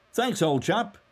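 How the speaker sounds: high, loud, brisk thanks; brisk cry. A narrator's reading. Mulligan thanks whoever whistled back, brisk cry. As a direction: call it out briskly, pitched right up. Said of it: "he cried briskly".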